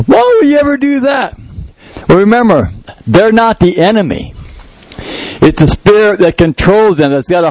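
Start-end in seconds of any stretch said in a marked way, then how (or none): 0.0-1.4 much higher, baffled and exasperated; exasperated disbelief. Retold or imitated. Parent reacting to a rebellious child, exasperated. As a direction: throw up your hands at the news. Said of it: "You go".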